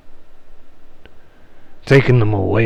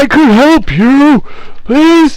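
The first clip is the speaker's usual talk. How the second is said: much higher, louder frantic wail; a ghost begging for rescue in panic; desperate spirit pleading; 8 dB louder than usual. Acted. Tormented spirit crying out to the party, much higher and louder.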